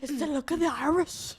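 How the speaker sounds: hesitant, amateur Irish accent; bad Irish brogue attempt. Shown as a demonstration. Embarrassed friend finally says the phrase, bad Irish accent. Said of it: "in an Irish accent".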